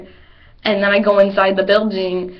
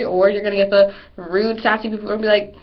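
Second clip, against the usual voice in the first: ordinary talk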